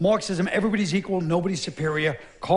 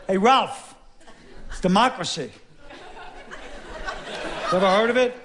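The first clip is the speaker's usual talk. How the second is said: mocking voters; 2 semitones higher than usual. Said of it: "We're like".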